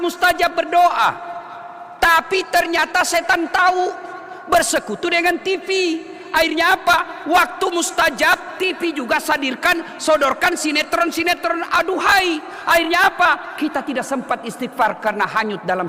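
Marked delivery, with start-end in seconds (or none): 2.0-13.5 raised, driving sermon voice; fiery preaching. Real, not acted. Preacher warns the congregation about TV at fasting time. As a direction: press the point hard to the crowd. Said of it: "preaching at full force".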